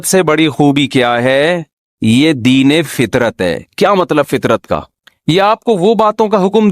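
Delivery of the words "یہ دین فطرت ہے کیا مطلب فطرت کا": ordinary talk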